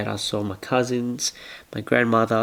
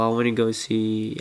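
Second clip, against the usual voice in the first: ordinary talk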